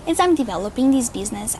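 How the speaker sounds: normal talking voice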